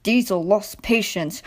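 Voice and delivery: plain reading voice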